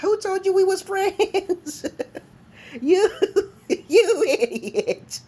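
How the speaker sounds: very high, drawn-out retort breaking into laughter; laughing mockery. Scorning the troll's claim of friendship, high and cracking up. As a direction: squeal the insult through laughs.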